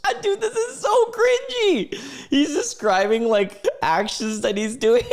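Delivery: high, laughing through the words; squealing with amused disgust; laughing cringe. Real, not acted. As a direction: cackle at how cringy it is.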